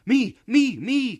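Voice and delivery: put-on very high, loud children's cries; eager cries. A narrator's reading. Children clamouring to be the one chosen, very high and loud. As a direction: clamour to be picked. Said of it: "cried the children".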